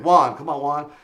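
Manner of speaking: a touch of an annoyed host calling out; pleading host. Retold or imitated. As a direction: beg for the mic back. Host begging for mic.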